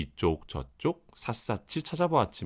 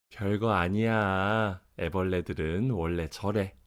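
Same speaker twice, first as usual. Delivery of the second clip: calm mother; 3 semitones lower than usual. Acted. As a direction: soothe the child like a calm mom. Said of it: "엄마가 말했어요". (차분한 엄마 목소리)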